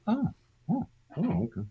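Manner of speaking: high, stunned little oh's of surprise; retold surprise. Actor hearing audience laugh, startled and pleased. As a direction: catch yourself off guard by the laugh. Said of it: "and I was like".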